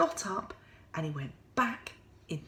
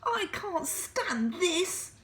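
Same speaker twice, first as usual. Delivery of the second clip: exasperated Mister Bear; 12 semitones higher, slower than usual. Acted. Mister Bear kept awake, slow high exasperated wail. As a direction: drag every word out in despair. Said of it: "said Mister Bear".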